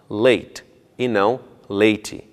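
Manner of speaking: "late" clean, then "late" said "lati"; slow contrast of "late" and "lati"; right then wrong. Shown as a demonstration.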